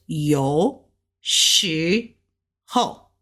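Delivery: yo low, shi rising up, ho dropping fast; Mandarin tones. Shown as a demonstration. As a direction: rise on shi then drop hard on ho. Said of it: "go up with the shi, ho, fast down".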